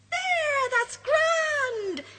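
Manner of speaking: high, loud cheeky sprite voice; a mischievous sprite teasing the friends. Acted. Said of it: "says the sprite".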